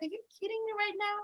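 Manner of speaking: high, fast disbelieving reply; incredulous. Retold or imitated. Answering a friend about her boyfriend, incredulous. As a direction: toss it back in quick disbelief.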